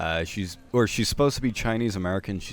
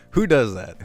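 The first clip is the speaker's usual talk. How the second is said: louder, incredulous who does that; exasperated; 4 semitones higher, 8 dB louder than usual. Real, not acted. Vlogger baffled by tourists asking students, loud disbelief. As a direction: throw up your hands at it, loud.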